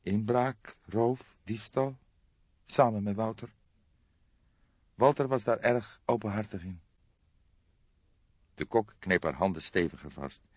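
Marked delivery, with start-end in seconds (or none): 0.0-6.8 put-on higher, tense young woman's voice; a shocked young woman breaking bad news; young woman Jolanda. Jolanda lists crimes. (geschokte jonge vrouw, hogere stem)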